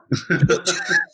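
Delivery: high-pitched giggle; high laugh. Real, not acted. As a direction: burst out in a squeaky laugh. Laughing at losing her point.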